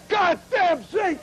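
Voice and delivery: mock a father snagging his shoe and blowing up; old man cursing. Father catching his shoe in the zinc strip, mock furious shout. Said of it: "He'd say".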